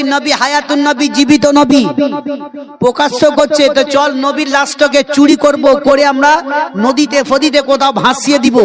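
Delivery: high, insistent sermon voice; full-force preaching. Acted. Preacher recounting a plot against the tomb, high insistent preaching. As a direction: hold the pitch up and press the story home.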